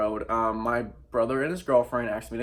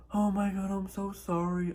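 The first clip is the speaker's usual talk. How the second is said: high mock whiny apology; high mock-sorry voice of a cheating ex; 9 semitones higher, 3 dB quieter than usual. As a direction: squeak it out like a pathetic ex begging. Mocking ex's apologetic voicemail.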